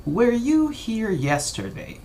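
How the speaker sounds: slow, high, drawn-out model question; teacher's exaggerated question. Shown as a demonstration. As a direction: lift it and stretch it for the class. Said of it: "si yo digo".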